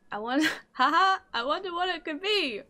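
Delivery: high voice with a nervous laugh. Acted. Villager wondering what the gift is, nervous. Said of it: "Why does he sound nervous?".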